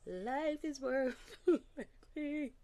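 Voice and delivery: high, slow words breaking into a laugh; emotional laughing. Real, not acted. Telling viewers life felt worth living, laughing up high. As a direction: let the joy spill over mid-phrase. Overcome remembering happy time.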